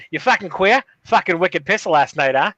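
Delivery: put-on joking Boston accent; hammed-up Boston local. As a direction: rib a buddy in broad Boston. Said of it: "I'm reading this in a Boston ac".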